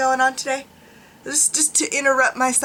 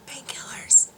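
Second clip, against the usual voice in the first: whispering. Real, not acted. Whispered aside.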